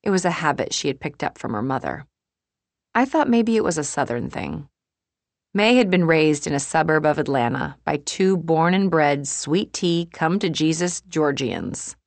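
ordinary delivery

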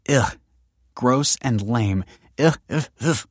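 drawn-out words between grunts of revulsion; playful disgust. Acted. Kelsey recoils from Michael's romantic talk, playfully disgusted. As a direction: shudder it off with repeated ughs.

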